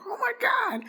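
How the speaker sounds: very high, squealed mock awe; imitated amazement. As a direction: squeal it like an astonished friend. Imitates friends gasping at her closet.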